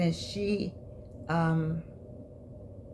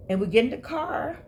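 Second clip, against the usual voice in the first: ordinary talk, nothing marked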